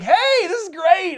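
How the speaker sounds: loud, high, slow fake cheer; mock-jolly villain greeting his family; Joker's cheer. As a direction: ham up a creepy host's delight.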